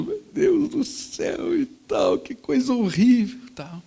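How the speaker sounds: high, slow, tearful wail; crying boy. Retold or imitated. Guilty boy crying over the pregnant wife's story. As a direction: retell it like a boy starting to cry. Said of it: "Eu já tava chorando".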